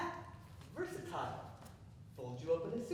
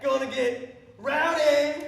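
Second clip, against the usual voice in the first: loud, higher party whoop; rowdy shout; 7 semitones higher, 14 dB louder than usual. Acted. Rowdy shout before song.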